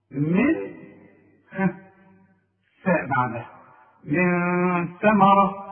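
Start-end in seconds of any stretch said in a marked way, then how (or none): 4.0-5.6 من ثمرة drawn out slowly, nun hidden nasally before the tha; إخفاء بغنة; slow tajweed recitation. Shown as a demonstration. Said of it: "اوعى تنسى الغنة".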